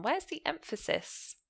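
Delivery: usual speaking voice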